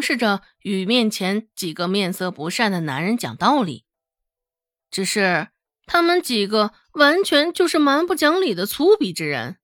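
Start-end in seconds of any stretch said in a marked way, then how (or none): none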